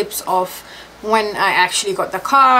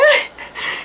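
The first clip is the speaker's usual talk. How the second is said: high giggle. Real, not acted. Awkward laugh about new car.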